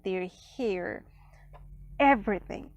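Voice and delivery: ordinary talk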